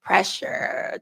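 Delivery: usual speaking voice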